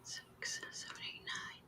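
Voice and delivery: whispered counting; whispering. Real, not acted. Counting under breath.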